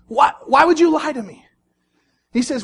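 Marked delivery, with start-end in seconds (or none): 0.1-1.5 angry. Acted. Delilah gets mad, acted.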